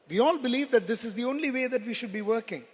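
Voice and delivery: normal talking voice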